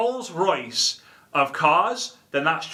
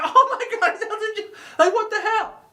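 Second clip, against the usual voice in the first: very high squealed disbelief; amazed reaction. Acted. Viewers seeing [name] on a livestream, amazed squeal. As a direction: shriek it like astonished fans, hammed up.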